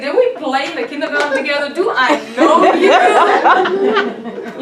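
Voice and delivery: loud, high retold outrage through laughter; hammed-up incredulous questions, drawn out at the end; laughing mock indignation. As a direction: imitate an affronted mother, cracking up.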